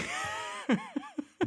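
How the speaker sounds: sudden high-pitched laugh. Real, not acted. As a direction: burst out laughing. Co-host laughs at crazy plot.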